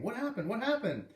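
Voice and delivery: put-on high, eager kid voices; curious kids. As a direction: ask excitedly like kids.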